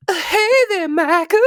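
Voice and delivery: high falsetto greeting; squeaky falsetto hello. Acted. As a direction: go way up high for a pop star hello. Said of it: "First impression, number one".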